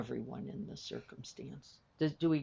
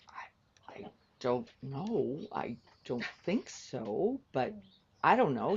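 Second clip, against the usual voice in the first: hesitant, unsure; 4 dB louder than usual. Retold or imitated. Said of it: "And I was like".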